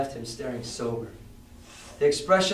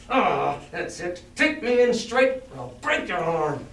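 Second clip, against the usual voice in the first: cruel threat; 3 semitones higher, slower than usual. A narrator's reading. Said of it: "a voice so cruel and cold and ugly".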